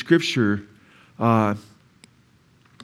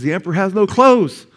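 raised high and loud, proclaiming it; boy calling out; 7 semitones higher, 5 dB louder than usual. Retold or imitated. Little boy exposing the emperor, loud and high. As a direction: announce it like the child who sees through it.

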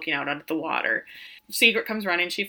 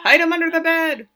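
high, loud comic scheming; playful urgency; 8 semitones higher, 6 dB louder than usual. Retold or imitated. Acting out raiding the hotel mini bar, playful urgency. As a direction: imitate an excited order. Repeats the acted minibar line.

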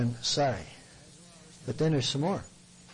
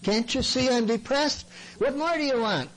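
high-pitched, exasperated plea; mock miserable complaint; 7 semitones higher than usual. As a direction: imitate a self-pitying protest, pitched well up. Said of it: "willing to be sad and miserable and depressed".

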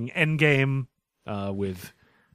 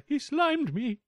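high operatic mock voice; Opera Man; 3 dB quieter, slower than usual. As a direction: belt it like a hammy opera singer. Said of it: "Opera Man, go".

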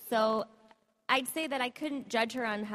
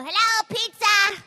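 put-on high, loud, slow little kid; a tiny kid answering the phone; preschooler voice; 12 semitones higher, 11 dB louder, slower than usual. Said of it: "my little one will pick up the phone and go".

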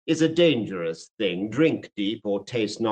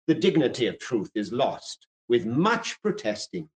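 ordinary talk, nothing marked

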